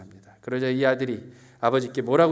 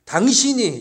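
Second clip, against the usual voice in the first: loud, high accusing cry; rude shout; 8 semitones higher, 10 dB louder than usual. Acted. Son accusing his father, loud high shout. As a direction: spit out the word 당신이 loudly. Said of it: "이렇게 얘기하고 있는 거예요".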